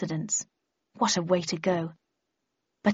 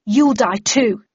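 louder, forceful threat; defiant shout; 2 semitones higher, 7 dB louder, slower than usual. A narrator's reading. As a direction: hurl the threat back.

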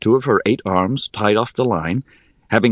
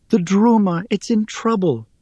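high, pleading voice; pleading Rhea; 12 semitones higher than usual. Acted. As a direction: beg for help, reaching up.

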